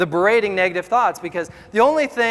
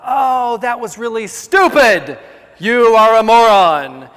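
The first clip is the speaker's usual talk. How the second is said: mock inner critic; 5 dB louder, slower than usual. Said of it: "the voice in my head says".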